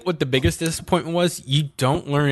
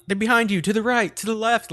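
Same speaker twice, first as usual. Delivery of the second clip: mock yelled warnings; 10 semitones higher than usual. Everybody warning the fighter, high half-hearted mock yell. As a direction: imitate friends calling out directions. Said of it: "everybody's yelling".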